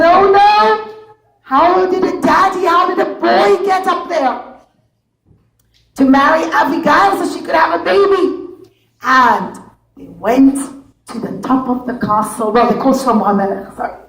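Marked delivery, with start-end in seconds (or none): 0.0-8.2 alarmed guards. Acted. Guards realise a boy reached the princess, high and alarmed.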